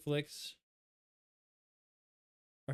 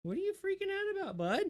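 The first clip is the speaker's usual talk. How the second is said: pet talk; 8 semitones higher than usual. Real, not acted. Owner asking her lizard what's wrong, high cooing pet talk. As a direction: coax the startled lizard in a high coo.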